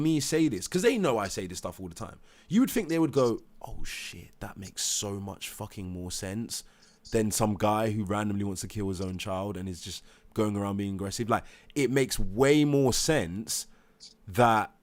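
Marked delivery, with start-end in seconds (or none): 3.6-11.3 hushed realisation. Pretend. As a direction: drop to a whisper as if realising. Imagined staff realisation, hushed.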